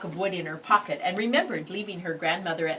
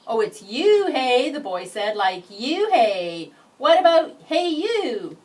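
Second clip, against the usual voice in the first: loud, high mocking play on words; a bus boy making fun of her name; teasing boy; 7 semitones higher, 11 dB louder, slower than usual.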